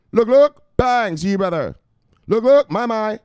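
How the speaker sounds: loud, high, punchy catchphrase yell; hype shout. Pretend. Streamer hyping his viewers with a loud high shout. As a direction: bark it out like a hype man.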